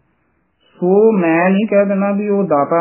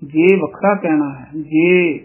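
normal talking voice

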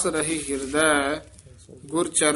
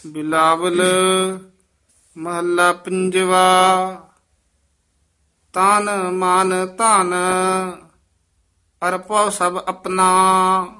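loud, measured scripture reading; full-voiced recitation; 3 semitones higher, 6 dB louder, slower than usual. Teacher reciting scripture aloud for students, full voice. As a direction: recite it out boldly for the class.

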